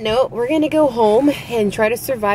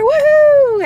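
loud high woohoo; excited cheer; 7 dB louder than usual. Real, not acted. Vlogger cheering goodbye to viewers, loud and high. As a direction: cheer the sign-off. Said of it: "signing off excited".

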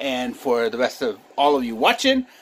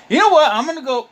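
sudden excited outburst; 13 semitones higher, 7 dB louder than usual. Real, not acted.

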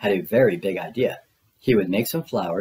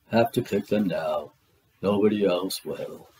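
lightly voiced glum Eeyore; low, soft and mopey; gloomy donkey voice; 3 semitones lower, 4 dB quieter than usual. Eeyore grumbling to Pooh about thistles, low and gloomy.

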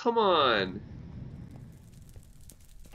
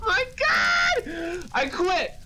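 exasperated shout; 13 semitones higher, much slower than usual. Real, not acted. Gamer losing all diamonds, shouting in exasperation. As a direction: yell it like you're giving up. Rage quitting after losing loot.

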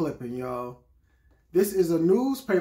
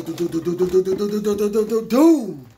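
vocal drum roll; 4 semitones higher, 7 dB louder, faster than usual. Pretend. Unveiling the new Jordans to viewers, building vocal drum roll. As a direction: mouth drum roll building louder and higher. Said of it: "that drum roll".

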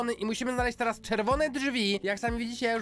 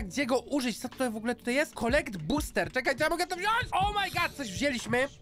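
ordinary delivery

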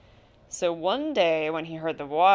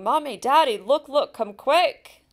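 put-on higher, louder children calling out; eager kids shouting for mom and dad; excited shouting; 6 semitones higher, 4 dB louder than usual. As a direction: call the parents over in excitement. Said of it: "they began shouting".

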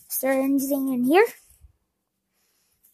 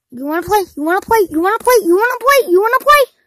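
each ask higher and louder; building excitement; 8 semitones higher, 13 dB louder, much faster than usual. Acted. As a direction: build from eager to shouting. Repeated, building up.

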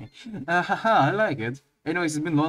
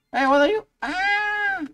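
startled shriek; slower than usual. Real, not acted. Startled by game creature.

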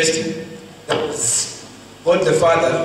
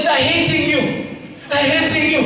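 higher, urgent plea to God; pleading protest; 8 semitones higher than usual. Retold or imitated. Preacher retelling his protest to God, pitched up. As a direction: protest like a hurt friend.

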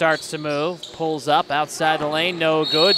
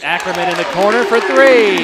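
building to a loud, higher-pitched shout on the shot; excited play-by-play call; 4 semitones higher, 9 dB louder than usual. Real, not acted. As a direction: call the three with rising excitement. Live call of three-point shot.

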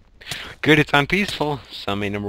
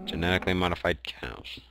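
quiet deadpan; 11 dB quieter, faster than usual. Real, not acted. Repeats flatly, quietly.

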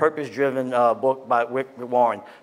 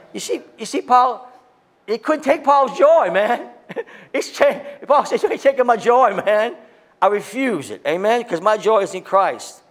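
high, loud fired-up preaching; pastor's full-force pulpit cry, higher and louder; 8 semitones higher, 4 dB louder than usual. Acted. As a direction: preach it hard and loud to the congregation. Preaching climax about joy.

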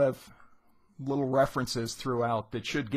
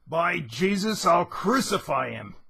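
higher, louder acted furious threat; angry threat; 5 semitones higher, 5 dB louder than usual. The citizen yelling after the fleeing Bloom, acted angry threat. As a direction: bellow the threat after him.

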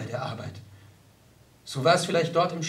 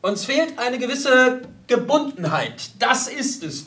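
raised, emphatic pronouncement; lecturing; 7 semitones higher than usual. Acted. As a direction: hold forth like a self-assured lecturer. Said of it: "dozierte er".

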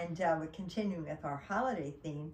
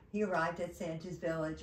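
ordinary delivery, nothing marked

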